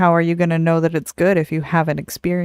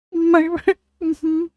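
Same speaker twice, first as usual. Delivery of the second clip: high, choked-up little squeak; mock tearful; 12 semitones higher than usual. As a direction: act out trying to answer through tears. Imitates her tearful reply to child.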